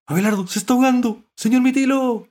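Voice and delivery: high, loud, rushed alarm; panicked shout. A narrator's reading. Dog fearing the mollusc is drowning, panicked shouting. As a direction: yell fast and high. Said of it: "le gritó Galleta".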